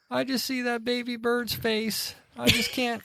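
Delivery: high, soft mock whimper; whimpering wife. Wife in bed haunted by baby bird, mock whimpering. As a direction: imitate a heartbroken wife whimpering, slowly. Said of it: "hear her start whimpering".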